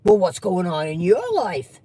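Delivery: lightly voiced prying relative, pitched low; low probing question, leaning on the end; nosy relative. Plays nosy relative questioning Trace.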